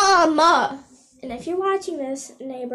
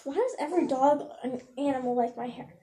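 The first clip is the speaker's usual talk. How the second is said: ordinary talk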